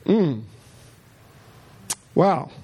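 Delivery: ordinary talk, nothing marked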